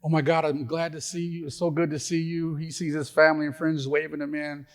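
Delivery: high, excited wife voice; bubbly, high-pitched delight; gushing wife. Acted. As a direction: gush at the husband arriving at heaven's gate. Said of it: "And so she's like".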